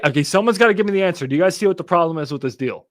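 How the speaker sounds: ordinary talk, nothing marked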